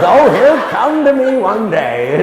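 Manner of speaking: hammed-up old lady sighing through a laugh; ninety-seven-year-old Ingrid. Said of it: "Ingrid, you're ninety-seven years old".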